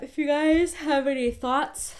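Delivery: talking through a yawn. Real, not acted. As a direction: say it mid-yawn, slowed down. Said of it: "I shouldn't yawn and talk at the same time".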